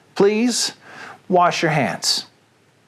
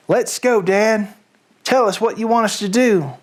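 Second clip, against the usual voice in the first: put-on a light, higher child voice, keen; eager fox cubs; 6 semitones higher than usual. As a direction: cubs urging their father to start. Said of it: "the small foxes".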